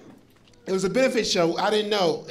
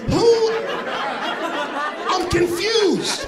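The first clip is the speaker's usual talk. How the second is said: baffled outburst; 9 semitones higher, much slower than usual. Acted. Confused rant about fan jersey.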